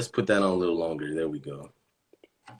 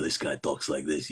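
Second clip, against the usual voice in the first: low, softer character voice; this guy's voice; 7 semitones lower, 6 dB quieter than usual. Acted. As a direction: drop low and quiet for this guy. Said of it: "this guy talks like this, I think".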